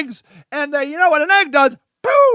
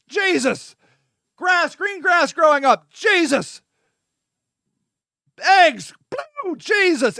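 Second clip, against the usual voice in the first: usual speaking voice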